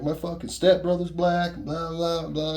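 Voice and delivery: high, loud outraged protest; indignant shout. Retold or imitated. Bully insisting to the narrator, indignant and loud. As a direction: shout it like you're insulted. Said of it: "He's like".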